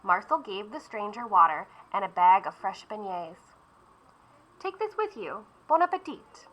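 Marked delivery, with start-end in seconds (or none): 4.6-6.5 high, soft kindly baker voice; a kind baker handing over a gift. Acted. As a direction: offer it gently, like a generous baker. Marcel gives gift kindly.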